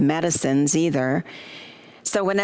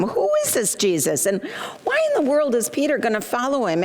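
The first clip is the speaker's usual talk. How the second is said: indignant, higher-pitched rush of questions; incredulous questioning; 6 semitones higher than usual. Acted. Mother-in-law questioning Peter's plan to follow Jesus, incredulous. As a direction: fire off disbelieving questions, pitch jumping up.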